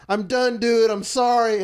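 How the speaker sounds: loud, high called-out apology; retold scream. Man calling to the cleaner next door, retold yell. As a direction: yell it toward the next room. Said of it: "screamed into the ladies' bathroom".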